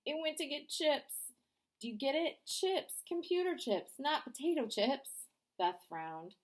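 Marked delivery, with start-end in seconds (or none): 0.1-5.2 high, pushy punchline delivery; eager joke teller. Acted. Danny pushes his joke on Beth, high and eager. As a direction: sell the joke hard.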